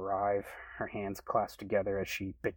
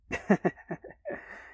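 high airy giggle; light laugh; 11 semitones higher, 3 dB louder than usual. Acted. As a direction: let out a light chuckle. Naya laughing at her fan moment.

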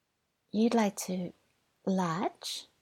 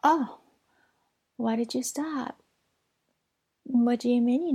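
surprised; 5 semitones higher, 5 dB louder than usual. Acted. Partner suddenly stops.

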